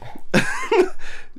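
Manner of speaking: high, falling fit of giggles; giggling helplessly. Real, not acted. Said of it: "I can't stop laughing".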